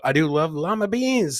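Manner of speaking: ordinary talk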